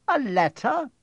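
high, incredulous echo; faint disbelief. A narrator's reading. McGonagall repeating Dumbledore's plan, faintly incredulous. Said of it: "repeated Professor McGonagall faintly".

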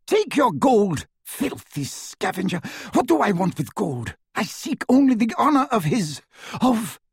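high, scornful, fierce; contemptuous witch. A narrator's reading. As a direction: scorn him, rising in fury. Bellatrix raging at Greyback.

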